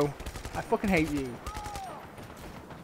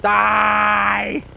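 loud drawn-out kill shout; 9 dB louder than usual. Real, not acted. Gamer firing at enemies, long loud shout. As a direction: bellow it as you fire.

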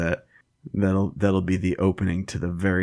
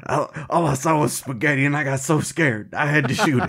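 higher, hammed-up panicky cop; mock-frightened policeman, pitched up; scared cop's excuse; 7 semitones higher than usual. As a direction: plead your case like a rattled rural officer.

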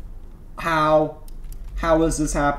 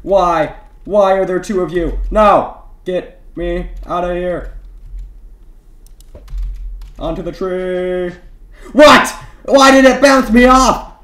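much higher and louder, frantic cries; panicked yelling; 4 semitones higher, 5 dB louder than usual. Real, not acted. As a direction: scream in real panic as enemies swarm. Ambushed in game, panicking.

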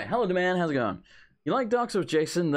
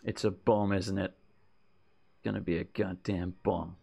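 lower, softer paranoid grumble; paranoid mutter; 8 semitones lower, 5 dB quieter than usual. Acted. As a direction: mutter darkly like he expects a bomb. Paranoid player character mutters.

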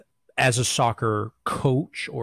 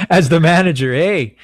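louder, higher excited repeat; excited echo; 5 semitones higher, 9 dB louder than usual. Real, not acted. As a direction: echo it back with big enthusiasm. Echoing joke loudly.

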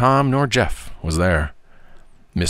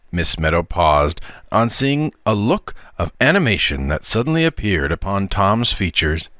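ordinary delivery, nothing marked

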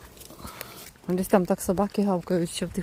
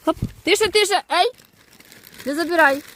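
scolding dogs; 7 semitones higher, 3 dB louder than usual. Real, not acted. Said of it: "я сварюсь просто".

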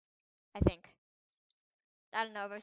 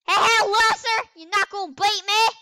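loud high mocking yell; taunting shout; 9 semitones higher, 16 dB louder than usual. Real, not acted. Gamer taunting an opponent, high loud yell. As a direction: jeer at the rival at full volume.

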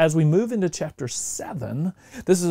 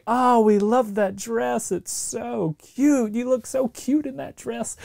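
much higher, slow, gushing compliment; gushing admirer; 8 semitones higher, slower than usual. Acted. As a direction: coo over the cute dress. Stranger gushing over a dress.

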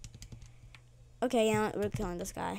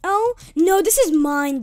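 possessive shout; 7 semitones higher, 9 dB louder than usual. Real, not acted. Claiming a kill loudly.

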